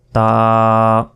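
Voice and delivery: তা with a long, level vowel; drawn out. Shown as a demonstration. Teacher repeating the letter for learners, drawn out. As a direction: hold তা for a second. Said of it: "এটাও এক second টেনে পড়বেন".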